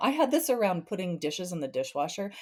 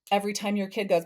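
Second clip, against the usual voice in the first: ordinary talk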